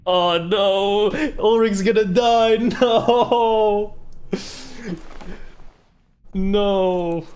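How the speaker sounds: high, loud panicked yelling; shouting in dismay at the screen; excited shout. Real, not acted. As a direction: cry out in real alarm. Reacting to a character's apparent death.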